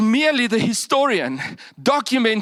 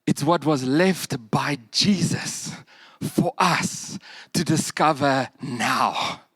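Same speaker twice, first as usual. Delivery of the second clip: usual speaking voice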